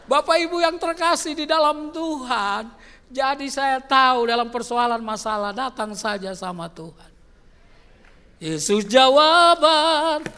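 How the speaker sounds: loud, high fervent preaching; preaching at full force. Real, not acted. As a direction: drive it home to the church.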